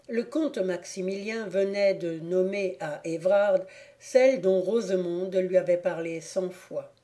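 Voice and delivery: ordinary delivery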